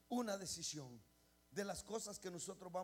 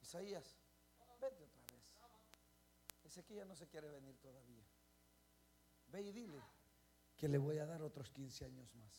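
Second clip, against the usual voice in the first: very soft, low God's voice; God quietly sending the prophet back; soft divine voice; 7 semitones lower, 5 dB quieter than usual. Retold or imitated. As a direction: speak gently, low and hushed. God speaking softly to the prophet.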